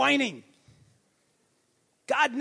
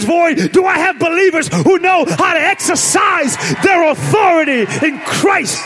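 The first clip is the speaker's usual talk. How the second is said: loud, rising preacher's cry, building higher; preaching at full force; 4 semitones higher, 13 dB louder than usual. Acted. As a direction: preach it out, louder with each line.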